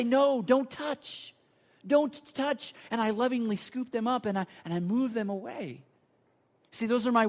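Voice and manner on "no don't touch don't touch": put-on high, raised urgent warning to a small child. Father jumping in to stop his toddler at the socket, urgent warning. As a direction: cry out to stop a toddler in time.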